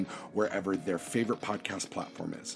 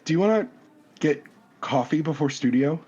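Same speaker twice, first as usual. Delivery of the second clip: higher and louder, a burst of nerves; bursting with nervous hope; nervous eager ask; 6 semitones higher, 8 dB louder than usual. Acted. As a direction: blurt out the invitation, high and loud. Nervous shy invitation after blushing.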